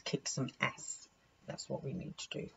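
whispered, fierce; whispered determination; aggressive whisper. Real, not acted. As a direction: hiss it like a plan of revenge. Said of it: "I know I'm being aggressive".

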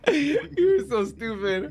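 slow words broken by laughter; slow laughing tease; laughing through words. Real, not acted. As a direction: say it while cracking up. Still laughing at friend.

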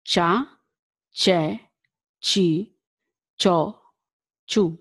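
Cha, che, chi, cho, chu each with a clear ch, as in chair; slow classroom drill; Italian ch syllables. Shown as a demonstration. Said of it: "Ch sound".

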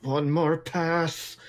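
slow, drawn-out self-talk; determined mutter to himself. Acted. Dob circling the zombies, slow determined mutter to himself. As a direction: stretch it out as if talking to yourself. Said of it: "you hear Dob mutter to himself".

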